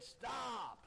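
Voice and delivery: high, sharp shout to halt; drawn-out hollered command; imitated holler. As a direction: shout it like the rider arriving. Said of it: "a man holler".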